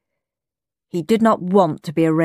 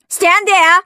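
high, loud teacher's shout; shouted order; 12 semitones higher, 7 dB louder than usual. A narrator's reading. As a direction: shout the command sharply, pitched way up. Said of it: "shouted Miss Tutu".

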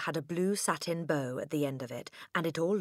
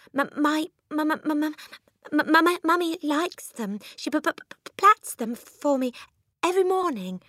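put-on high, loud stammering little girl; terrified stutter; 12 semitones higher, 8 dB louder than usual. Frightened girl stammers back at the furious headmistress. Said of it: "managed to stutter".

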